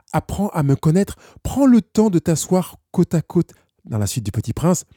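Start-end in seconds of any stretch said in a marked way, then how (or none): 0.1-3.5 invitation insistante, plus haut perchée; voix de l'émotion. Acted. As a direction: inviter avec instance, en prenant son temps. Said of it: "que vous puissiez l'entendre vous dire". (demande pressante, portée plus haut)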